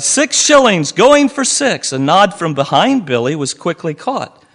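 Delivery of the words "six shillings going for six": high, loud auction call, slow at the end; auctioneer's cry. A narrator's reading. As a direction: call the bid to the room. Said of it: "the auctioneer cried".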